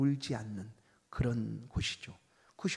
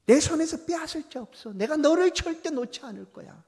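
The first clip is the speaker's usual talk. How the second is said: much higher and louder declaration; God's strong promise; 13 semitones higher, 9 dB louder than usual. Acted. God's voice protecting a believer, high and loud. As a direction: proclaim the promise with full force. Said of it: "이게 주의 목소리입니다".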